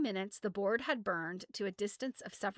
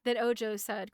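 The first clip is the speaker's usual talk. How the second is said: ordinary delivery, nothing marked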